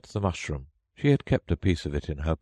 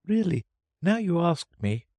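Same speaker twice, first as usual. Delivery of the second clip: confused; 12 semitones higher than usual. A narrator's reading. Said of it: "very much confused".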